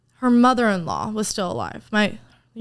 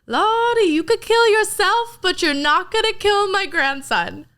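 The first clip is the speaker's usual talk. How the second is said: high, loud and slow grandma voice; imitating grandma; 11 semitones higher, 5 dB louder, slower than usual. Grandma warning mom at dinner, high imitated voice. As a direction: raise the pitch way up and warn slowly. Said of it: "she goes".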